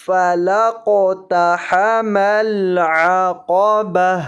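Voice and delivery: slow drawn-out chant, "با" closed with an audible h; waqf, stopping reading. Shown as a demonstration. Teacher repeating the stop rule, slow recitation ending "با" with h. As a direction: end "با" on a still h in place of ta. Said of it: "ہا سے بدل کر پڑھ دینا ہے".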